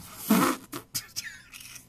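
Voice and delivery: silent shaking laugh that stops the sentence; a breathy giggle that won't stop; breathless laughter. Real, not acted. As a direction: crack up and give in. Cracks up mid-sentence.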